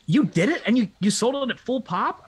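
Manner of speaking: high, loud, astonished owner; amazed boss. Retold or imitated. Owner stunned his salesman sold the car, loud disbelief. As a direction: act stunned at the sale. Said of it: "the owner comes out and goes".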